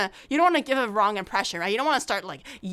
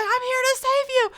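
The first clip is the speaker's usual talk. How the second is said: much higher, grand hero line; mock heroic rescuer; 14 semitones higher, 3 dB louder than usual. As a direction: play the cartoon savior.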